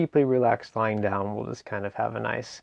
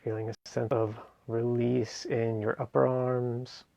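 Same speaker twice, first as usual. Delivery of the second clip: guided meditation. Acted. Meditation teacher guiding listeners through a seated relaxation, guided meditation. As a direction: ease them gently through each phrase, leaving space between.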